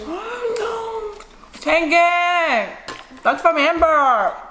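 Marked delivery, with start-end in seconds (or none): 0.1-0.7 high startled gasp; surprised. Real, not acted. Daughter pops balloon. 1.6-2.5 high, loud call of a name. Real, not acted. Calling out to someone.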